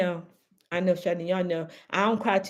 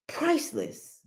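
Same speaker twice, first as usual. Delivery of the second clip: Priceless repeated much higher and softer, like a hushed marvel; high, soft echo; 8 semitones higher, 6 dB quieter than usual. Shown as a demonstration. Echoing herself to viewers, priceless lifted high and hushed.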